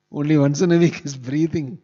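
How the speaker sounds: preacher astonished at such control; emphatic amazement. Real, not acted. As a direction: stress the wonder of it. Marvelling at Dhruva's austerity.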